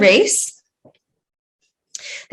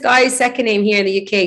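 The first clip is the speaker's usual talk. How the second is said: ordinary talk, nothing marked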